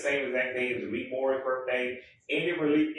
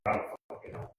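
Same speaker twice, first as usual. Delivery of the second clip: whispered aside; 6 dB quieter, faster than usual. Real, not acted. Muttered aside after rambling.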